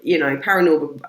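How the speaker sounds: normal talking voice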